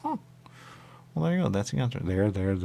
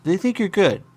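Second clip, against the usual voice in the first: loud mock questioning; 9 semitones higher, 6 dB louder than usual. Questioning suspect in character.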